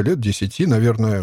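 plain reading voice

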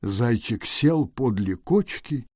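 ordinary delivery

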